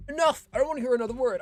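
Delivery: loud, high-pitched indignant outburst; angry outburst. Acted. Angry guest refusing the staff's excuses, indignant. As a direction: cut them off loudly.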